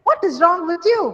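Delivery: high, forceful angry question. Shown as a demonstration. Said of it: "It's like you're angry".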